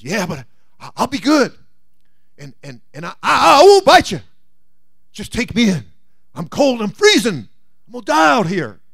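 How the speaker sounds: high, loud stammering snake; pleading snake. Acted. Snake promises not to bite.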